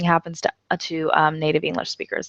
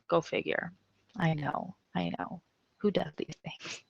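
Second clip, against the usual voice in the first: whisper; 14 dB quieter than usual. Real, not acted. Mock exasperated aside.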